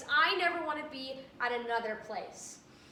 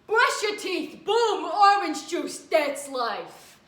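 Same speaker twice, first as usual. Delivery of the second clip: loud, high, slow barking commands; tough-guy bark; 5 semitones higher, 9 dB louder, much slower than usual. Retold or imitated. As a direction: bark it like a gruff cop. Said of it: "He was more of a".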